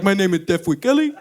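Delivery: dropping the deaf act; clear plain speech. Retold or imitated. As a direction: suddenly articulate confession. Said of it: "by the time they were leaving".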